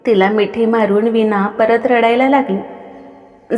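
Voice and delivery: usual speaking voice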